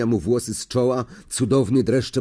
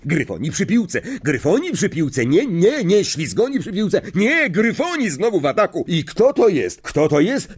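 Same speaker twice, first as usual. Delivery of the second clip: rising, much higher excited play-by-play; excited live commentary; 6 semitones higher, 3 dB louder than usual. Acted. Lee to the crowd, excited commentary. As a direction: shout the goal to the stands.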